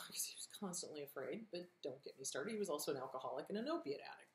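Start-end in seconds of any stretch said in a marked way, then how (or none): 0.1-2.5 whispered aside. Real, not acted. Aside about abusive dad.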